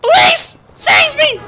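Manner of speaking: very high, loud panicked squeal; over-the-top high shriek for help; mock cry for help. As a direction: scream for rescue, hammed-up. Calling for help in the game.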